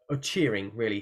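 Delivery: ordinary delivery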